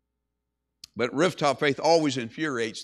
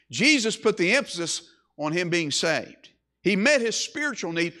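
ordinary talk

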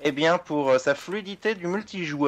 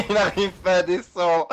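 laughing; 4 semitones higher, 5 dB louder than usual. Real, not acted. Mocking friend while laughing.

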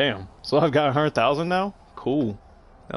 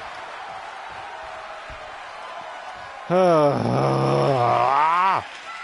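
long, drawn-out groan of exasperation; exasperated groan. Real, not acted. Gamer fed up with the game, long exasperated groan. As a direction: let out a long sigh that swells into a groan.

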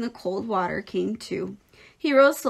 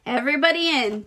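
raised, rounding-up call; higher call to the whole group; 5 semitones higher, 4 dB louder than usual. A narrator's reading. Father calls family into truck, calling out. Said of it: "called Mister Arable".

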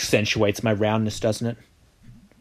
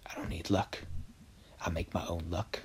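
whisper; 4 semitones lower, 16 dB quieter than usual. Real, not acted. Whispering to herself.

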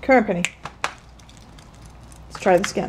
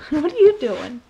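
laughing question; 5 semitones higher than usual. Real, not acted. Laughing at the pet.

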